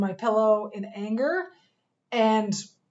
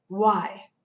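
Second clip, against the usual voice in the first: a curt retold "Why?" with a slight edge; lightly imitated defensive challenge; 3 dB louder than usual. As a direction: push back with a touch of edge. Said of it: "with a bit of an edge".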